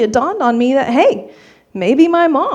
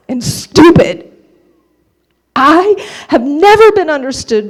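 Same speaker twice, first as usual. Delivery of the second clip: high, loud angry yelling at mom; enraged, full-voiced tirade; furious outburst; 5 semitones higher, 8 dB louder than usual. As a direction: lose it completely, shouting each accusation. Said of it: "I lost my shit".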